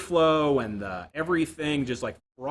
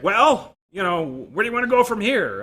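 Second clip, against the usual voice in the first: higher and louder mock boss question; 8 semitones higher, 8 dB louder than usual. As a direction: voice the boss turning it back to you. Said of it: "he's like".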